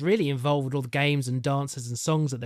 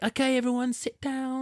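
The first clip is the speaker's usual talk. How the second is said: Mrs Claus voice; 9 semitones higher than usual. Retold or imitated. Missus Claus addressing children.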